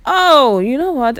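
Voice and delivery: high loud "oh" of sudden inspiration; sudden idea. Real, not acted. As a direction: burst out as the idea hits.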